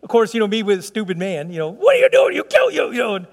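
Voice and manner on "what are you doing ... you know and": high, loud retold yell at a child; angry yell. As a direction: snap at her in shock. Father yelling at daughter about limo.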